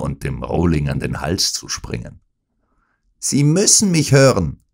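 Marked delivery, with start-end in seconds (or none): 3.2-4.6 louder, higher shout; villain shouting to be heard. Acted. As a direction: yell it down at the dying man. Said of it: "schrie er".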